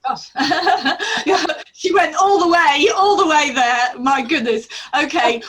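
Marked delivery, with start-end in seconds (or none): 0.3-4.7 loud laughing exclamation, higher; laughing loudly. Real, not acted. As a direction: burst out laughing as you speak. Laughing at the friend's long story.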